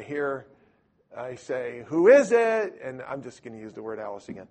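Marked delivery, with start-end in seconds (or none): 1.9-2.8 loud call through a door. Retold or imitated. Answering a knock on the door, loud call.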